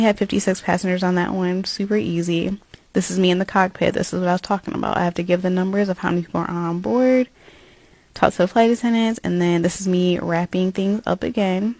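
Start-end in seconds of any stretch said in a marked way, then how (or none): none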